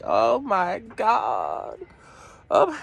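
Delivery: a slow drawn-out oh my God; lightly voiced exasperation; mild slow groan of dismay. Caregiver stepping outside overwhelmed, slow dismayed sigh. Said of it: "just be like".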